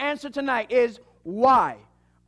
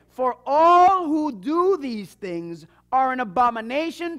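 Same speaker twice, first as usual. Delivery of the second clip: emphatic scripture reading; 5 dB louder than usual. Acted. Reads the scripture passage.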